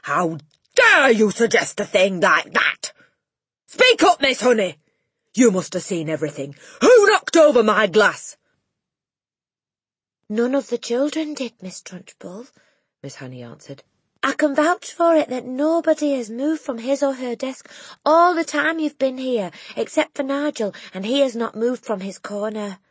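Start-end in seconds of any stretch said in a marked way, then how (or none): 0.0-8.3 loud, higher outraged bellowing; furious shouting. Acted. Headmistress raging at class and teacher. 10.3-12.5 a hint of a gentle teacher. Teacher answering the headmistress firmly. 14.2-22.8 a hint of a gentle teacher. Teacher vouching for the class.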